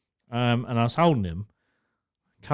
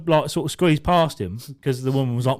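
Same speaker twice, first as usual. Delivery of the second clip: ordinary delivery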